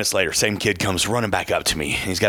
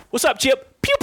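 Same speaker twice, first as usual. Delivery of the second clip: cheesy finger guns. Retold or imitated. Greeting Chipper in the concourse, high goofy pew pew. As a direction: blurt it out like an overeager fan. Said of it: "I gave him finger guns".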